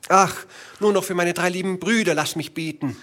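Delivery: high, begging plea fading away; wife begs Bluebeard to let her pray; pleading wife. A narrator's reading.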